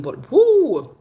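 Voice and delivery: a loud, high, rueful "woo!"; exaggerated whoop of disbelief. Real, not acted. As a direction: let out a big loud woo about the bad service. About Gloria's service.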